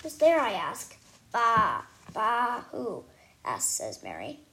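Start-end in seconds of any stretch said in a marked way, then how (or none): 0.0-0.4 loud call through the door. Acted. Girl calling through a bathroom door, loud. 1.3-3.0 bah, bah, bleated loudly; sheep bleat. Acted. Sheep answering a knock-knock, bleating.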